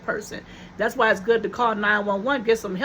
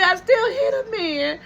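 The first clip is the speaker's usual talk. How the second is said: high, teary half-laughing cry; retold tearful insistence; 7 semitones higher than usual. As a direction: sob out the words with a laugh. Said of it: "I was crying".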